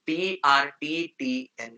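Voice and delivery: slow spelling. Shown as a demonstration. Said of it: "spelling letters slowly".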